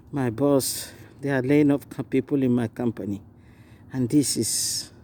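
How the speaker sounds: low, subdued voice of a man facing layoff; a lightly voiced anxious worker, dropping low; an acted congregant fretting about losing his job, low and downcast; worried believer. Voices a laid-off believer's worry.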